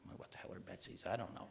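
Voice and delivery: whispered puzzled question. Retold or imitated. Narrator wondering what Betsies are, whispered puzzlement. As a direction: whisper it, baffled.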